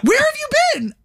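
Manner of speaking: very high, startled mock yell. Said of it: "He jumps and yells again".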